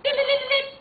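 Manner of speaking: high, loud chirpy ring; ringing bell sound. Pretend. Buzzer before answering.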